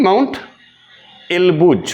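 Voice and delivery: মাউন্ট, a clear pause, then এলবুজ; pronunciation model. Shown as a demonstration. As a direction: say মাউন্ট and এলবুজ as two separate pieces for learners. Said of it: "উচ্চারণ করতে হবে".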